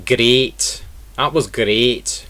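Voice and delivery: "great" with a strong Scottish R; Scottish rolled R. Shown as a demonstration. Teacher demonstrating Scottish English, strong R in "great". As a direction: roll the R in "great", Scottish style. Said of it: "you can hear the R sound in Scottish English".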